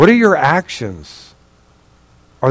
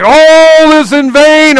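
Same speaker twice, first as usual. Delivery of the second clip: despairing cry; 12 semitones higher, 7 dB louder, much slower than usual. Retold or imitated.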